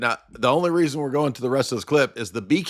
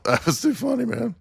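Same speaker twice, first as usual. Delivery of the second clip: laughing; 7 semitones higher than usual. Real, not acted. Speaks through laughter.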